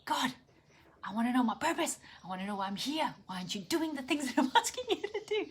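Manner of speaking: mad at God. Retold or imitated. Frustrated worshipper railing at God about her purpose, mad. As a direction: demand answers in a pleading, exasperated rise. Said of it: "I was mad at God".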